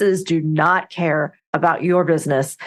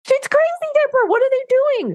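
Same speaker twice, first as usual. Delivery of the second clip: shrieking far higher, hammed-up panic; mock alarm. Driver telling Deborah about crazy roads, high mock shriek. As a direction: squeal it like the lane's about to kill you.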